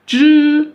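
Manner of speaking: "Zhi" held high and level; "Zhi" as a high level first tone; first tone demo. Shown as a demonstration. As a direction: sustain "Zhi" on one high flat pitch. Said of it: "on tient le premier ton".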